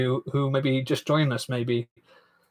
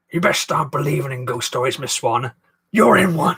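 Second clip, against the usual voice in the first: Barbossa; 4 dB louder than usual. Acted. Quoting Barbossa to Miss Swan in an impression. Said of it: "Do you like my impression?".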